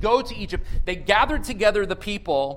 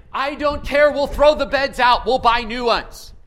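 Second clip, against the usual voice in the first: put-on loud, high outburst at the kids; worked-up mom; 4 semitones higher, 8 dB louder than usual. Mom in the video telling the kids, worked-up and loud. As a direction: imitate a mom loudly laying down the law. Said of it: "she was telling the kids".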